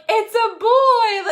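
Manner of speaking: high, loud, drawn-out squeal through laughter; tearful, laughing shout of joy; emotional joyful cry. Retold or imitated. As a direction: re-enact an overjoyed announcement, voice soaring. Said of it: "And I was so emotional".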